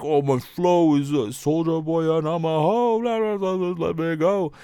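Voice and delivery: high, dopey mock of a bragging rapper. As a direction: ham up a clueless rapper. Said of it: "because he was like".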